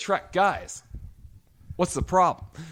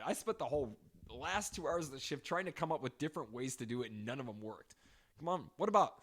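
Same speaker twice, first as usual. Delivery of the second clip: ordinary talk, nothing marked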